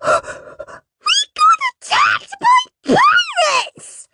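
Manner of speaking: very high, drawn-out panicked wail. A narrator's reading. Parveen reports to Mum and Dad, high wail. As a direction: wail it slowly like a terrified child. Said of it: "wailed Parveen".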